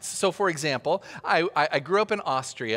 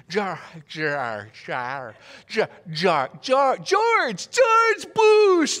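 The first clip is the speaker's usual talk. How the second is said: imitating friends fumbling; 5 dB louder, much slower than usual. Azeri friends trying to say [name], fumbling then triumphant. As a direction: stumble then land triumphantly.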